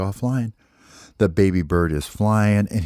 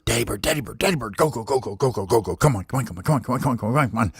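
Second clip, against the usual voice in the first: put-on eager baby bird; 3 semitones higher, much faster than usual. Baby bird pestering sleepy daddy to go, eager. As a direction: urge daddy up, coaxing and impatient.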